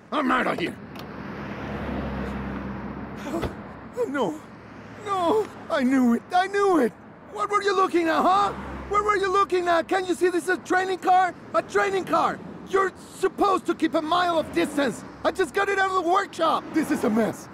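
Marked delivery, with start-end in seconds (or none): 0.8-4.0 long, low, deflated sigh; heavy sigh. Acted. Instructor after the training car crash, a long, low sigh of dismay. 5.0-16.6 angry rant. Acted. Instructor rants over damaged car.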